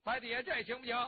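ordinary delivery, nothing marked

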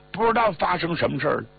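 ordinary delivery, nothing marked

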